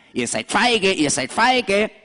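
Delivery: taunting goat. Acted. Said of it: "der schreit immer wieder".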